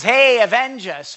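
loud, slow mock call; comic loud plea for vengeance. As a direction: bellow it jokingly to God.

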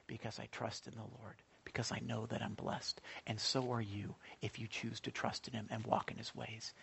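very soft, low and sincere; hushed testimony. Real, not acted. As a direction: let the voice sink into quiet trust. Sincere testimony of trust in God.

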